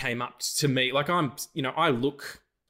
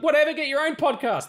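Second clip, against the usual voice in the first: dismissive yell; 6 dB louder than usual. Retold or imitated. Retold yelling at driver.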